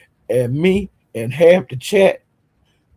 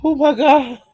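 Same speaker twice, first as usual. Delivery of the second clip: high, laughing exclamation; 10 semitones higher, 4 dB louder than usual. Real, not acted. Cracking up at Lemon's line, laughing hard. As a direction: crack up while saying it.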